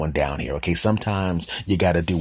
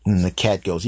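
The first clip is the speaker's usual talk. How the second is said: ordinary talk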